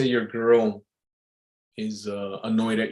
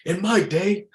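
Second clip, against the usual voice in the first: put-on higher-pitched old-timer voice; 8 semitones higher, slower than usual. Bar regular recalling his day, old-timer voice. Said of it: "I had this old timer".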